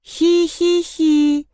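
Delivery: high, slow monotone "hee-hee-hee"; eerie toy giggle. A narrator's reading. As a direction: giggle like a spooky toy, drawn out. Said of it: "Тихо, но отчётливо прошелестело". (жуткое протяжное хихиканье)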